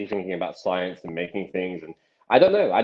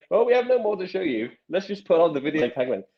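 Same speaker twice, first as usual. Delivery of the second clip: much higher put-on voice; imitated shop demonstrators; 9 semitones higher than usual. Magician mimicking shop staff out of demos, high mock voice. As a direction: pitch it up as a mock shop worker.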